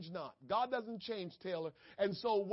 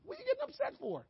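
higher and fast, a light scolding question; God chiding Cain; much faster than usual. Acted. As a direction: tease him like a father nudging his son. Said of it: "God said to Cain".

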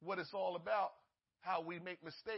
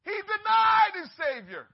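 shouted at full force, pitch way up; fiery preacher's cry; preaching shout; 12 semitones higher, 18 dB louder, slower than usual. Real, not acted. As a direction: thunder it at the congregation.

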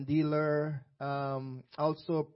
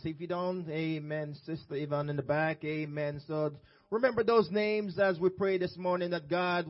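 ordinary talk, nothing marked

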